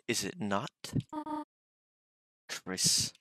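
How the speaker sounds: lilting British mom voice; soft British accent. Acted. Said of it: "It was more of a soft British accent".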